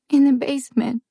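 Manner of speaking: put-on a sad little girl confessing; child still teary from crying. A narrator's reading. Child continues.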